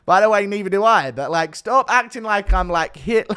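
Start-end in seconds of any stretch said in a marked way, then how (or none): none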